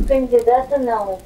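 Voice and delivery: ordinary delivery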